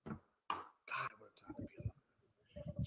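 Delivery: breathy near-silent whisper. Pretend. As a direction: whisper it like a secret. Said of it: "you know how long it is to talk like this?".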